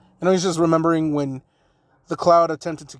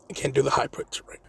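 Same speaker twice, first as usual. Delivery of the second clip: whispered, embarrassed admission; whispered aside; 2 semitones lower, 5 dB quieter than usual. Real, not acted. Narrator apologizing for the failed voice, whispered. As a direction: drop to a whisper and confess to the audience.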